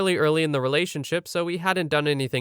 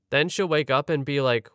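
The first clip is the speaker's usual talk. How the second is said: usual speaking voice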